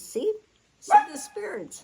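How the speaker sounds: dying friend pointing out the spirits, high and insistent; voicing the friend. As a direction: call out like a man seeing spirits. Quoting dying friend's question.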